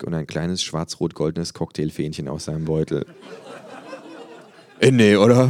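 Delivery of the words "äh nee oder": loud, incredulous fan; disbelieving. A narrator's reading. Fan watching the lighter come out, loud disbelief. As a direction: gasp it out in disbelief. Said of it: "fragt unser Patient ungläubig".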